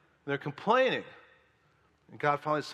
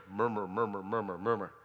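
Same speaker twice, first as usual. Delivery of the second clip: low, grumbling murmur under the breath; mock murmuring; 6 semitones lower, 4 dB quieter than usual. Preacher mocks the church's grumbling, low murmur. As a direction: mutter it like a sulky complainer.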